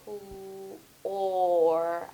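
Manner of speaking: "Pl-" soft, then "or" held separately; sounding out. Shown as a demonstration. Teacher listening for the vowel, sounding out "Pl-" and "or". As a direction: pull "Pl-" apart from a drawn-out "or". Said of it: "what vowel sound do I hear".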